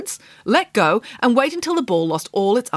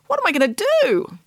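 princess; 10 semitones higher than usual. Acted. Princess frets.

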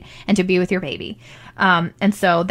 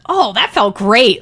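relieved delight; 5 dB louder, slower than usual. Retold or imitated. New mother after the placenta slides out, relieved delight. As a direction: re-enact a big, happy relief.

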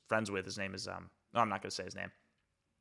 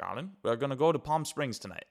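higher-pitched imitation of a German accent; 4 semitones higher, 4 dB louder than usual. Friend springing a trip on him, mock German accent. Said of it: "he has this German accent".

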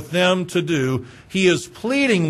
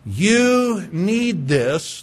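very slow; much slower than usual. Real, not acted. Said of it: "final slow plea".

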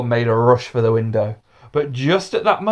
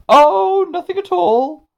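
high, bright-eyed denial, a loud Oh; falsely innocent girl; 4 dB louder than usual. A narrator's reading. Pandora Green assures the teacher nothing is wrong, innocently. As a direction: play dumb sweetly to the teacher.

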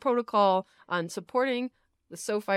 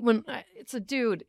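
usual speaking voice

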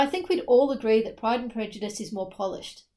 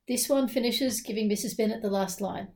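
ordinary talk, nothing marked

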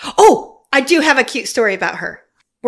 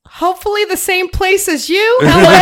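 laughing flirty line; 8 semitones higher, 4 dB louder than usual. Retold or imitated. Retelling Drew's flirty reply.

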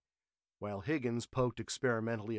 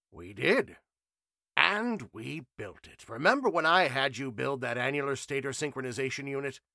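higher, louder, amused assurance; confident professor; 4 semitones higher, 6 dB louder than usual. A narrator's reading. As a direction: chide a doubting friend fondly. Trenchley voiced, proud.

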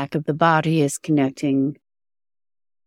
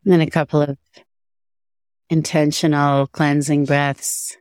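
guided meditation. Acted. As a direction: guide attention gently through the body.

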